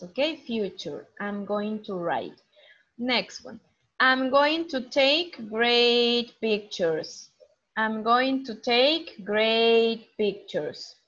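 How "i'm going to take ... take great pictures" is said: slow clear model reading. Shown as a demonstration. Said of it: "Fotografía".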